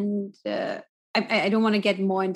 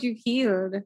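usual speaking voice

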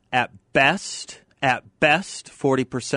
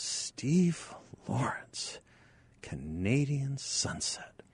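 mock hushed; 4 semitones higher, 7 dB quieter, much slower than usual. Bennett misnaming the singer, punchline.

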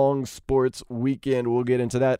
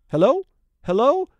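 calling out; 10 semitones higher, 5 dB louder than usual. Acted. Host calling out to absent partner.

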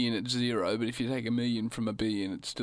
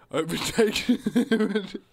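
laughing; 8 semitones higher, 5 dB louder, much slower than usual. Real, not acted. Silly idea cracks him up.

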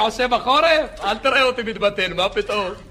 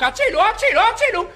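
retold scream; 9 semitones higher than usual. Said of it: "היא התחילה לצעוק".

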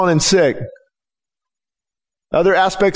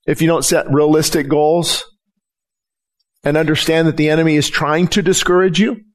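usual speaking voice

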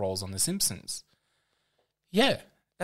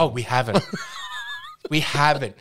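laughing; 7 dB louder than usual. Real, not acted. Caught forgetting main character.

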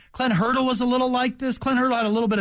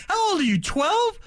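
mock scolding; 10 semitones higher than usual. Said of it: "I'm like".

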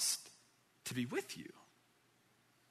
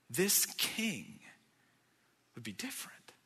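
whispered refrain; 6 dB quieter than usual. Acted.

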